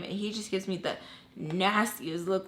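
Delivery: normal talking voice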